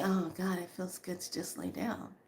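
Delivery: soft, sinking relief; relieved sigh. Pretend. Lying down after a tiring day, soft relief. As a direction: let it melt out as you lie down.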